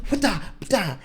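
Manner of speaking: two sharp high karate strike cries; imitated strike noises. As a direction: punch out two quick kick sounds. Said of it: "mid-conversation, he just goes like".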